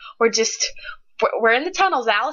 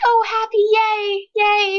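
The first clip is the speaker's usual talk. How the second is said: much higher, louder sing-song yay; sarcastic fake celebration, pitched way up; mock cheer; 11 semitones higher, 4 dB louder, slower than usual. Reviewer mocking the book's happy stretch with high fake cheer.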